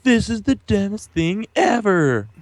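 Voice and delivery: high, drawn-out mock complaint; mock outrage. Host mimicking critics of the update, mock outrage. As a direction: whine it slowly like an exaggerated hater.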